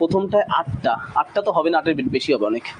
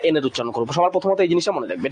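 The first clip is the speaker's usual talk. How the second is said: ordinary talk, nothing marked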